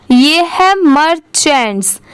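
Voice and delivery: ordinary talk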